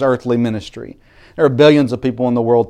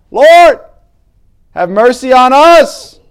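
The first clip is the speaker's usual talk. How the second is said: higher, even louder desperate cry; 11 dB louder, slower than usual. Retold or imitated. Blind men shouting over the crowd, louder higher cry. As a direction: push the plea harder over the crowd. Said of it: "And these blind men got louder".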